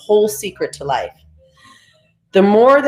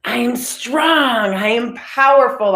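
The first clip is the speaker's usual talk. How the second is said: higher, slow, proud declaration; with conviction; 5 semitones higher, slower than usual. Shown as a demonstration. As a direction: claim each word like it's true. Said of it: "Repeat after me out loud and with conviction".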